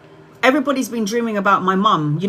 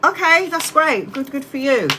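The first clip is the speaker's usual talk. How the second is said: sarcastic approval; 5 semitones higher than usual. Retold or imitated. Answering her sister, with fake cheer. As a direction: pretend to be pleased through gritted teeth. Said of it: "I'm going".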